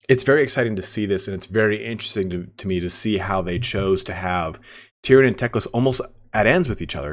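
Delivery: normal talking voice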